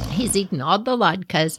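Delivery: usual speaking voice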